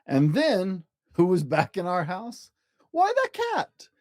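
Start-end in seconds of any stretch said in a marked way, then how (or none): 2.9-3.9 much higher, startled exclamation; surprised. A narrator's reading. Narrator reveals the cat is back in the house, surprised. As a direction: announce the surprise return, wide-eyed.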